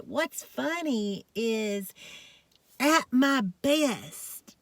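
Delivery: usual speaking voice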